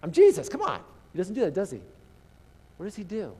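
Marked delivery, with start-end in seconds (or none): 0.0-0.8 loud, high hammed-up hey hey; brash mock self-introduction; mock showy announcement. Jesus as he did not act, loud mock boast. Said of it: "He's like".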